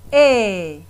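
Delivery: "E" said as an open è, held clearly; the è sound. Shown as a demonstration. Teacher showing the children the "E" sound, held clearly. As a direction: model the open "E" vowel for the class. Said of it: "qui se prononce".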